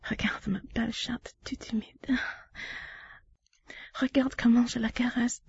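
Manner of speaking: hushed, close to the mic; intimate whisper. Acted. To her partner, guiding him in a whisper.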